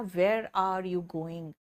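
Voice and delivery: "Where are you going?" very slow and clear; slow model sentence. Shown as a demonstration. Teacher modelling a sentence, slow and clear. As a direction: draw out each word for students to repeat. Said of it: "आप कहना".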